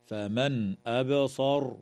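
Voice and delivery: أبصر with the ba bounced lightly; ba echo. Shown as a demonstration. Teacher showing the echoing ba. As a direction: give the ba a small echo. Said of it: "وتقلقل الباء في كلمة أبصر".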